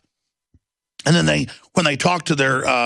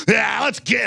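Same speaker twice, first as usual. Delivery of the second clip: higher, shouting; mock panicked exit; 7 semitones higher than usual. Mocking party guests fleeing him, high and shouting. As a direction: hurry everyone out.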